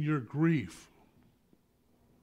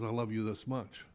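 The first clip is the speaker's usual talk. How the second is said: tender Jesus voice; 6 semitones lower, 8 dB quieter than usual. Pretend. Jesus answering the believer, soft and low. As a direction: lower and much softer, gentle. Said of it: "Jesus says".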